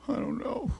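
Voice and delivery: put-on high whispered little-boy whimper; tearful son answering his dad in a whisper; crying boy.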